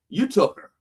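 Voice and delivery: grieving man accusing God. Acted. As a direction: throw the blame straight at God. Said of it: "he's blaming God for the loss".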